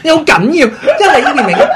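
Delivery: loud giggling tease; laughing tease. Real, not acted. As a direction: laugh while teasing. Laughing tease about open shirt. (笑住講)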